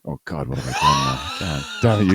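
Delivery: ordinary talk, nothing marked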